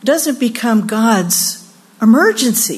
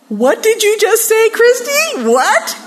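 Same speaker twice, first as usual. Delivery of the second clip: high, loud disbelieving outburst; astonished; 12 semitones higher, 4 dB louder than usual. Retold or imitated. Mother retelling her reaction to her daughter's remark, astonished. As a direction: react in exaggerated shock, pitch way up.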